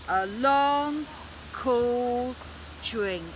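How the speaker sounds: slow, stretched words sinking at the end; drawn-out words. A narrator's reading. Storyteller giving the dragon a long drink, drawn out.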